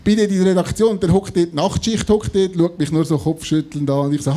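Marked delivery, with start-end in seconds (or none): none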